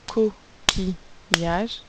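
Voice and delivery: "Coquillage" drawn out, co-qui-llage; slow syllables. Shown as a demonstration. Teacher splitting "Coquillage" slowly for pupils. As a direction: stretch each syllable of "Coquillage". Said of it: "trois syllabes".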